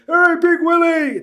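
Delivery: loud high drawn-out shout; stretched-out stadium call to a famous player; calling out in unison. Retold or imitated. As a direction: hail him across the stands. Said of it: "the three of us collectively go".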